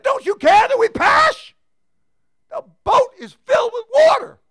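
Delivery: high, loud frantic cry of fishermen; panicked disciples shouting. Acted. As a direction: shout it like men about to drown. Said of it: "And they said".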